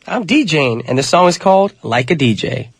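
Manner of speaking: usual speaking voice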